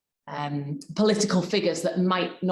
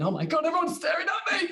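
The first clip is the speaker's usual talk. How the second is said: high, panicky mock thought; panicked inner voice; 11 semitones higher than usual. Speaker imitating a nervous presenter's panicking mind. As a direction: squeal it like sudden stage fright.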